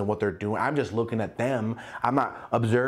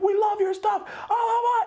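gushing fans. Retold or imitated. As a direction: squeal your love like a superfan.

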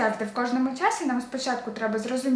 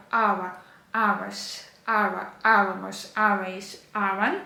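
ава, аваш, авамос, авейш, аван, each stressed on the opening А; stress on first A; 2 semitones lower, slower than usual. Shown as a demonstration. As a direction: drill ава, аваш, аван as separate endings, hitting the first А. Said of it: "наголос у нас всюди падає на ось цю першу А".

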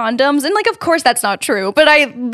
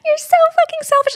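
much higher, squeaky repeat of You're so fucking selfish; shrill mock whine. Repeating the boyfriend's text to her co-host, high squeaky mock whine. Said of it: "him just replying".

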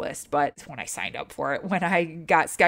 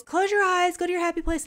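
very high, airy sing-song self-coaching; mock self-soothing; 14 semitones higher than usual. Patient talking herself into the scanner, mock soothing. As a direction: coax yourself in a tiny high voice.

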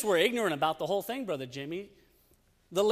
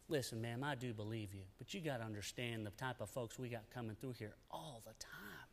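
much lower and far softer, almost hushed; soft relenting officer; 9 semitones lower, 19 dB quieter than usual. Retold or imitated. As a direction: quietly let the mother off. Border agent finally relenting, softly.

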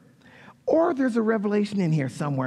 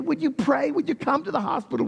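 pleading request; 7 semitones higher than usual. Acted. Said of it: "And you pour out your heart".

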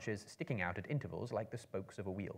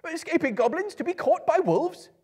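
much higher, louder despairing cry; panicked hobbit; 14 dB louder than usual. A narrator's reading. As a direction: wail in disbelief. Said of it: "he said".

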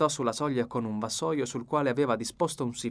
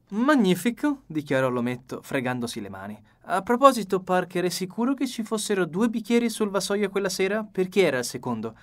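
higher, lively fussy detective; the dapper detective, pleased with his plan; Poirot voice; 7 semitones higher, 5 dB louder than usual. A narrator's reading. Poirot pleased, questions Parker.

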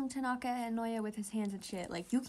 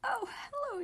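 cooing; 12 semitones higher, slower than usual. Real, not acted. Cooing at characters.

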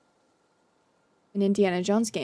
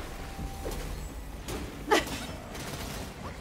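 breathy laugh; 8 semitones higher, 11 dB quieter than usual. Real, not acted. Laughs after failing.

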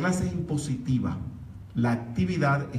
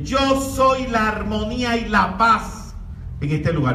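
projected voice; 7 semitones higher, 6 dB louder than usual. Shown as a demonstration. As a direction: command peace over the street. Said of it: "impostas tu voz".

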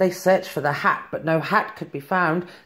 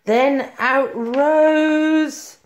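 very slow, drawn out; 6 semitones higher, much slower than usual. A narrator's reading. Magic broom appears.

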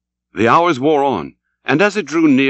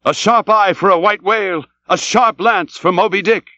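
high, eager sailors' slogan; spirited battle cry; rallying shout; 8 semitones higher, 3 dB louder than usual. Acted. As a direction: cry it out as one crew. Crew shout their rallying slogan.

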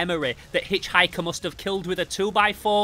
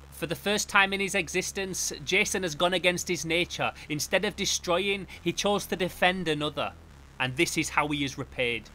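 soft, measured, grave reading; solemn comic narration; 3 semitones lower, 5 dB quieter than usual. Acted. Reading what goes on inside Jason's head, solemn narration. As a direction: read it quietly like a tragic inner monologue. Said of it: "the narration lets us know".

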